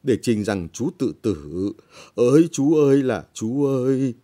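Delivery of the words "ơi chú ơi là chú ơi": higher, louder put-on wail over the body; hammed-up grief, wailing the dead uncle's name; mock wailing lament. Relative fakes a loud wail over the hanged man.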